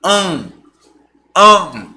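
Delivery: AN as one held nasal sound; long nasal AN, no separate N; nasal vowel. Shown as a demonstration. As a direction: sound out AN through the nose. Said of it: "Você tem k, an".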